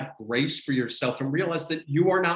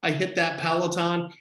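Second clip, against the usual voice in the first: usual speaking voice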